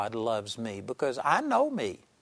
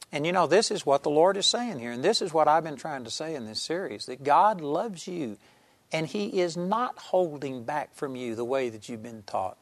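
normal talking voice

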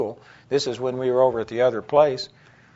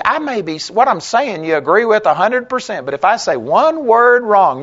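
higher, louder heated scolding; retold tirade; 5 semitones higher, 5 dB louder than usual. Preacher lets the nitpicking critic have it, higher and louder. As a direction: pour it on, building heat.

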